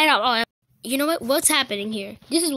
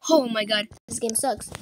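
ordinary delivery

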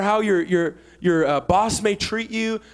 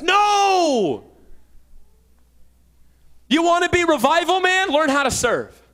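higher, louder shouted preaching; fiery preaching; 7 semitones higher, 4 dB louder than usual. Real, not acted. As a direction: fire up the congregation. Preacher at full force.

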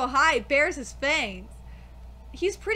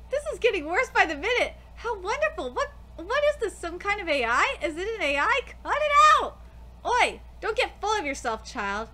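ordinary talk, nothing marked

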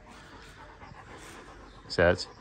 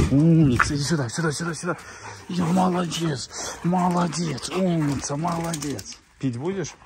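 talking to a dog; 8 semitones higher, faster than usual. Real, not acted. Cooing praise at the dog. (сюсюкая с собакой)

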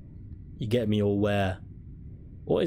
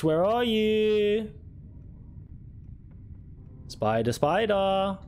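taunting; 8 semitones higher, much slower than usual. Real, not acted. Hunting ghost with shotgun.

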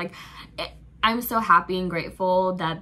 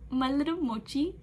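cutesy doting; 6 semitones higher than usual. Real, not acted. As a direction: coo it to him like a pet name. Doting on [name].

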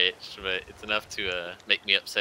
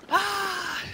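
frustrated yelp; 4 dB louder than usual. Real, not acted. Frustrated at far distance.

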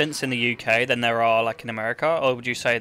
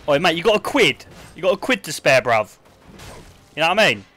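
louder, higher mock Cockney; Cockney accent; 3 semitones higher, 5 dB louder than usual. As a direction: cadge off a mate in loud Cockney. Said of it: "Can I do my best Cockney?".

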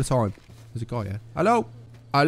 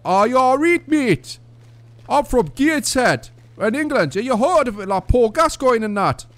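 put-on Geordie accent; 6 semitones higher than usual. Chatting up a stranger in a put-on Geordie accent. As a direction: ham up a Gateshead accent to a stranger. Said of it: "I can't keep these, this accent up much longer".

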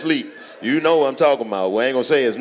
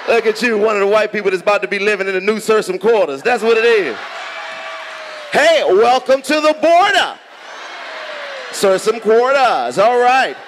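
hyped roast; 8 semitones higher, 4 dB louder than usual. Real, not acted.

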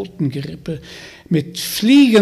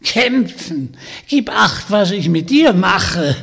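threatening witch; 3 semitones higher, 4 dB louder than usual. Acted. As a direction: scoff then warn like a hag. Hexe droht Vanya. (drohende, hohe Hexenstimme)

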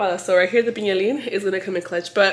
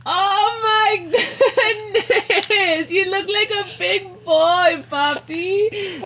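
higher, slowish doting coo; 9 semitones higher, much slower than usual. Real, not acted. Mother coos at her son after his haircut, doting. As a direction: gush over him like a proud mom.

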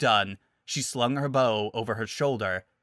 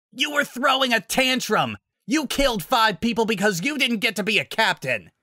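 shouting accusation; 11 semitones higher, 4 dB louder than usual. Acted. Hero shouting accusation back.

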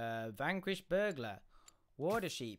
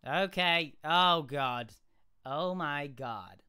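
put-on American exclaiming, loud at first; American accent; 8 dB louder, much slower than usual. Shown as a demonstration. UK streamer keeping up the act, American accent. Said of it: "this is how I'm gonna talk".

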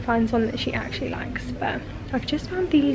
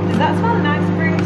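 raised, louder coaxing pet voice; pet talk; 5 semitones higher, 5 dB louder than usual. Real, not acted. Owner asking Bruce if food smells nice, high pet talk. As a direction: ask the dog brightly, pitched up.

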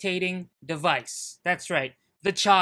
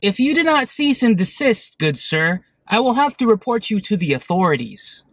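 loud, stiffly proper complaint; overly formal polite driver; 3 semitones higher, 10 dB louder than usual. Acted. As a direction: play a prim, polite motorist. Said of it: "that little skit I just thought up".

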